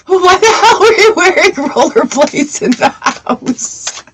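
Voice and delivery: high, slow laughing question; giggling disbelief at the rollerblades. Real, not acted. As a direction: ask it while laughing hard. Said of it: "laughing at someone".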